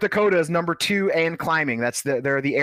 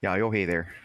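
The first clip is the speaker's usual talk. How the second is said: low mock Wisconsin greeting; playful Wisconsin twang, pitched down; Wisconsin accent; 6 semitones lower, 3 dB quieter than usual. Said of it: "Oh, the Wisconsin accent".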